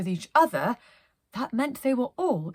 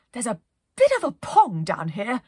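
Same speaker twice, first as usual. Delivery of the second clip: plucky captain voice; 3 semitones higher, 7 dB louder than usual. Acted. Penelope's voice in story.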